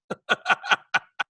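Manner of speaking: breathy, voiceless burst of laughter. Real, not acted. Bert after mocking his own begging, wheezy laugh. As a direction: crack up after your own joke.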